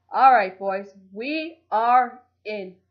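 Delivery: loud, slow triumphant call; gleeful. Acted. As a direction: announce the win with glee. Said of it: "Michael declared gleefully".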